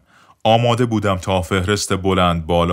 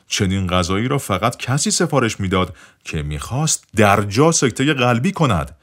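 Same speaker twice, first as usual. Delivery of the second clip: plain reading voice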